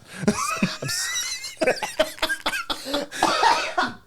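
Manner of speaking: very high, long laughing fit; helpless laughter. Real, not acted. Co-hosts losing it over a crude joke, helpless laughter. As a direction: lose it completely in real laughter.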